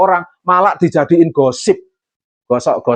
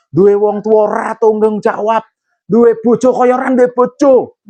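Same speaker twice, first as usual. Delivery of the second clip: quoting a complaint in a higher, louder whine; mock lament; 8 semitones higher, 4 dB louder than usual. Pastor to congregation, mocking a Facebook moan. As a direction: read out a sulky Facebook moan.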